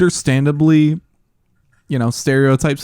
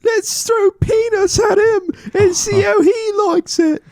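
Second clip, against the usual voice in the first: cartoon character voice. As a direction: shout it like a wacky cartoon critter. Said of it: "what does he say?".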